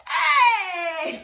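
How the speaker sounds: high, loud drawn-out cry; a long, high-pitched exclaimed hey; loud exclamation. Real, not acted. Co-host reacting to accents as the next topic, loud exclamation.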